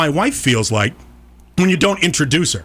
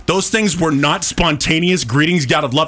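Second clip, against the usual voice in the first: ordinary talk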